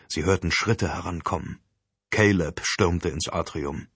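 ordinary delivery

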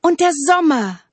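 high, loud enthusiastic call. A narrator's reading. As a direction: cry it out as the eager trees. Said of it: "riefen die Bäume". (hoch und laut rufend)

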